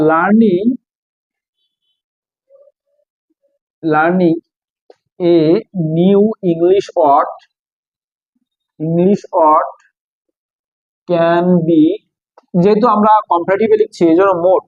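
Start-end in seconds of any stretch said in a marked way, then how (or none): 0.1-11.8 very slow, piece-by-piece, long gaps between words; dictating while writing. Real, not acted. Teacher composing an English example for students, slow dictation. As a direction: draw it out as you write, repeating words. Writes the next example aloud.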